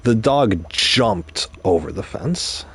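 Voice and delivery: "jumped" drawn out and punched; stress on JUMPED. Shown as a demonstration. Teacher showing listeners how emphasis changes meaning, stress on "jumped". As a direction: slowly, lean hard on the word "jumped". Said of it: "If I emphasized a different word".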